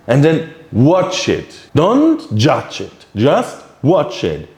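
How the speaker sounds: slow and deliberate, leaning on watch; slow firm instruction. Shown as a demonstration. Teacher telling meditators to just watch, slow and firm. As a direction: drag out each word so watch it sinks in.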